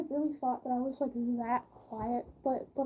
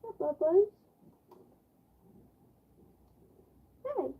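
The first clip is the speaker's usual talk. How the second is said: pet talk; 4 semitones higher than usual. Real, not acted. Talking to her dog.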